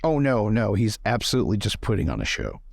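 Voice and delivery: ordinary talk, nothing marked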